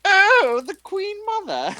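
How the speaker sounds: very high, hammed-up Cockney voice; terrible Cockney accent. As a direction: squeal it in a bad Cockney accent. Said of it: "terrible Cockney accent".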